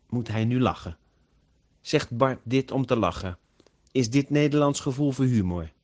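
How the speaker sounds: ordinary delivery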